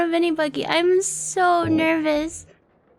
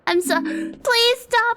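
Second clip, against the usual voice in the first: frantic apologizing; 5 semitones higher than usual. Real, not acted. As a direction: plead sorry over and over, voice climbing. Over-apologizing nervously to the chat.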